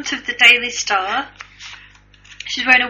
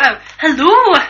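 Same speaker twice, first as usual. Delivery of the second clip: hello in a put-on Scottish accent; 6 semitones higher, 11 dB louder than usual. Answering the co-host's prompt with a Scottish accent. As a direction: greet the audience in a mock Scottish lilt. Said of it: "you're not doing your Scottish accent".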